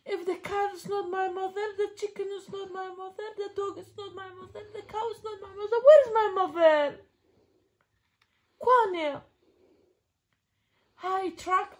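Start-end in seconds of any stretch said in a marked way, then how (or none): 0.0-7.0 sing-song read-aloud, building to loud question; reading to a toddler. Acted. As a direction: read it like a bedtime book. Parent reads picture book to toddler.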